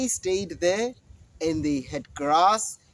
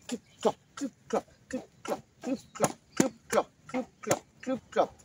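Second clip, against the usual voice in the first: quiet, higher clip-clop rhythm; soft hoofbeat chant; 5 semitones higher, 6 dB quieter than usual. Acted. Goats crossing the bridge, soft clip-clop chant. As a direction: tap out the hooves softly.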